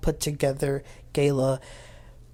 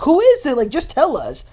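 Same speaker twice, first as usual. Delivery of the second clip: eager demand; 8 semitones higher, 8 dB louder than usual. Retold or imitated. Everybody demands to know who.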